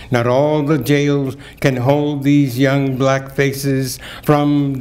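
solemn, building poetry recital; poem reading. Acted. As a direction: read it like a testimony. Reads a poem aloud.

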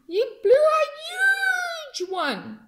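high, loud, slow stretched cry; hammed-up astonishment. A narrator's reading. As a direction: stretch it out in wonder. Narrator hams up huge bubble.

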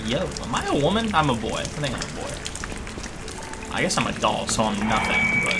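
ordinary talk, nothing marked

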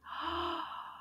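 soft, drawn-in gasp; little gasp of discovery; surprised gasp. Pretend. Reveals a picture clue to children.

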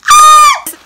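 high loud happy shriek; excited scream. Real, not acted. Vlogger delighted by her makeup, happy scream.

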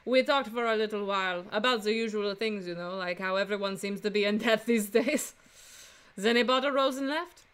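put-on Frenchwoman's French accent; female French accent. Flower seller answering a defense attorney, female French accent. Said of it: "do another female French accent".

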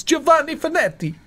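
hammed-up Italian accent on the name. Player joking about the diary writer's name, Italian accent. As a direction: roll the name out in a showy Italian voice. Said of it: "in an Italian accent".

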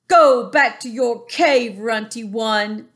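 loud, slow, menacing giant voice; booming giant. A narrator's reading. Bully giant mocking the BFG, loud and slow.

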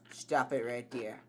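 ordinary delivery

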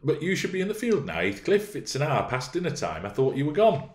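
put-on high, quick girlish voice; high-pitched young miss, put out; young Catherine. Narrator voices Catherine, surprised at Heathcliff.

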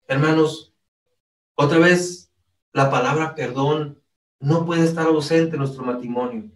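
ordinary delivery

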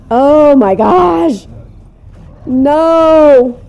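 loud, high panicked outcry; alarmed cry. Real, not acted. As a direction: cry out as it all goes wrong. House under zombie attack.

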